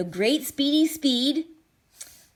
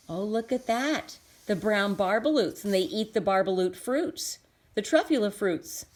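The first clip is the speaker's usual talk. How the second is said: usual speaking voice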